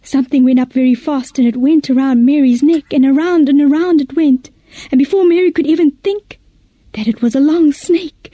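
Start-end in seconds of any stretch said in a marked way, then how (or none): none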